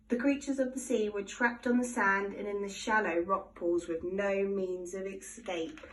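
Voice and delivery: ordinary delivery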